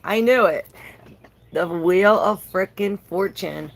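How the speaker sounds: excited I-knew-it. Real, not acted. Card she predicted turns up.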